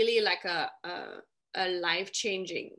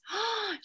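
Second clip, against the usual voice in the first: high, astonished gasp; imitated gasp of surprise; mock gasp; 11 semitones higher, 4 dB quieter than usual. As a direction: gasp as if shocked by the news. Imitates people's shock at her origin.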